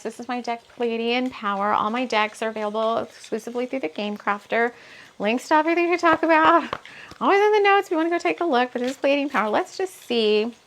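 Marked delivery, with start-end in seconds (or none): 5.2-8.7 higher, louder fast plug; excited plug. Real, not acted. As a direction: rattle off the plug brightly. Plugging links in notes.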